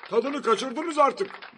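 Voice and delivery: high, loud, slow scolding; exasperated high-pitched complaint; annoyed. A narrator's reading. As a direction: scold a crowd that won't stop. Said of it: "Başbakan kızmıştı".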